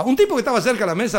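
ordinary delivery

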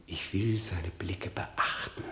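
whispered. Acted. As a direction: plot it under your breath. Hamlet plotting against his uncle. (verschwörerisch geflüstert)

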